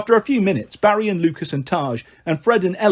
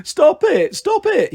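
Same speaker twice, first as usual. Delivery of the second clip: very high-pitched squeal of protest; ticklish protest; 11 semitones higher, much slower than usual. A narrator's reading. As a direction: plead through tickles, pitched way up. Sheep tickled while being sheared.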